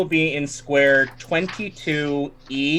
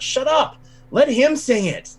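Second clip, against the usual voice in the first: much higher, louder hushing patron; pushy tavern patron; 11 semitones higher, 4 dB louder than usual. Retold or imitated. Patron elbowing neighbours to hear the song, high and loud. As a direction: shush the crowd in a high, loud caricature. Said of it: "elbows the other people, like".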